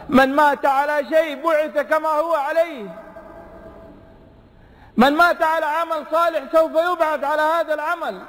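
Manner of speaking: preaching at full force. Acted. Preacher proclaiming the hadith to the congregation at full force.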